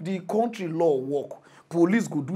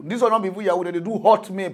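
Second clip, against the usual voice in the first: ordinary talk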